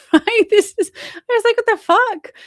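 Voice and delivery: high, loud incredulous squeal; high-pitched what-the-fuck shock; shocked disbelief. Real, not acted. Shocked at making the local paper.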